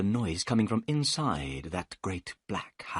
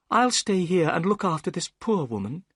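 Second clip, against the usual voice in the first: put-on high, calm young woman's voice; steady and kind; Miss Morstan; 8 semitones higher, 3 dB louder than usual. A narrator's reading.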